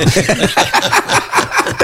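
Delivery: silent wheezing laugh; breathless laugh. Real, not acted. Father laughs at his own joke, breathless wheeze.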